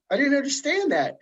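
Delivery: high puzzled voice; confused. Retold or imitated. Young reader baffled by British slang, high and confused. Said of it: "And I was like".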